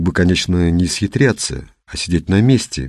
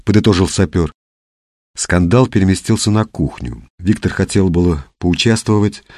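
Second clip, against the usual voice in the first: usual speaking voice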